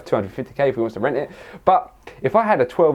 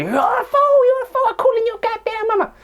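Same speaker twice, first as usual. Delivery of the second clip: high-pitched hammed-up scolding teacher; mock an outraged teacher in a squeal; ranting teacher; 3 dB louder than usual. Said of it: "the teacher was standing over me".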